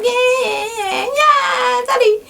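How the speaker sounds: put-on high squealing baby talk. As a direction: squeal like a baby calling daddy.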